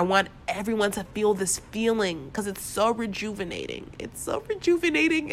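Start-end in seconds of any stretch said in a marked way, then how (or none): 4.0-5.3 high cracking voice, holding back tears; fighting not to cry, and the voice breaks upward. Real, not acted. Overwhelmed vlogger sharing gratitude alone in his room, voice cracking near tears. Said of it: "my voice is cracking because I'm trying not to cry".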